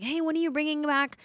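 imitating eager fans.

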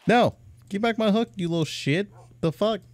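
high, loud indignant yell; outraged yell. Real, not acted. Gamer yells at a thieving villager, outraged. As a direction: yell at the thief to give it back. Villager stole his hook.

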